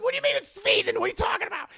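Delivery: high, loud outraged disbelief; mock incredulous outburst. Storyteller voicing horrified visitors arguing with villagers, incredulous mock yelling. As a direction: demand answers, imitating a frantic tourist.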